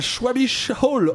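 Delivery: "Schwabisch Hall" said slowly in a Frenchified way, "hall" like "ol"; badly pronounced German. Real, not acted. Host reading a German town name, knowingly mispronouncing it. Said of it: "je vais le prononcer très mal".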